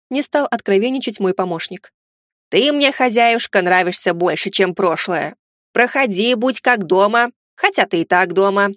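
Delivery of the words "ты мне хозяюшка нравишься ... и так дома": usual speaking voice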